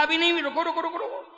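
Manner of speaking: high, tumbling string of stops; urgent halting. Acted. Boatman halting the Lord before he sits, urgent and high. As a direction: rattle it off high, fading out.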